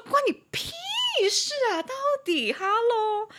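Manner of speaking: high, loud mock retort, slowing on hello; mock furious reply to the nosy coworker; imagined snapback. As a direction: snap back in a hammed-up high voice. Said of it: "我想说".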